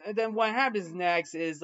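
normal talking voice

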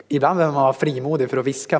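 usual speaking voice

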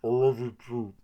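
mock talking through food; mouth half full. Host imitates character half swallowing food, mouth full. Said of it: "half swallows his food".

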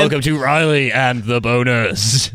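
slow, hammy radio host intro; announcer voice. Acted. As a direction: announce it like a morning zoo DJ. Jokey show intro announcement.

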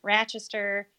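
nasal upstate New York accent; native Rochester accent. Shown as a demonstration. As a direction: Rochester accent, completely through the nose. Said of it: "Have I not done my native accent".

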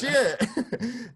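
high-pitched excited curse, drawn out; retold thrilled reaction, breaking into a laugh; excited exclamation. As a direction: let it jump up high. Acted excited reply to offer.

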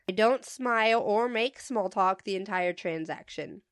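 ordinary delivery

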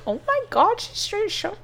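high-pitched shocked gasp. Retold or imitated. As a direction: squeal it, scandalized. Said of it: "And I was like".

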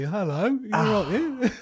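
high, laughing British greeting; mock his old English accent through laughter; casual Brit hello, hammed up high; put-on English accent. Mocking own old English accent.

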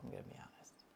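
breathy confiding whisper; whispered aside. Real, not acted. Admitting to listeners that birth was awful, whispered. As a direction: lean in and whisper it.

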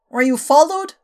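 sharp, loud urgent question. Acted. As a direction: demand it tensely. Scott urgently asks.

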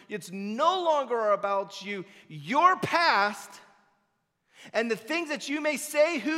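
loud, rising preacher's call; preaching at full force. Acted. Pastor urging the congregation, preaching at full force. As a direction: drive it home from the pulpit.

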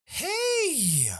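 high, bubbly, excited voice; eager young woman. Acted. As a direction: gush over the visitor eagerly.

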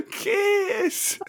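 very high squeaked word; quoted movie line. Acted. Host repeating a random quote for laughs, high mimicking squeal. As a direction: mimic the film line far up in pitch.

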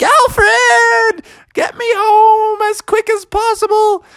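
high shouted plea; urgent shout. Acted. Froggy dangling from the mattress begging Alfred, high shout. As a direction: yell up at the owl.

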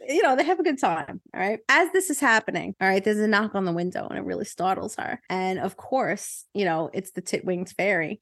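ordinary talk

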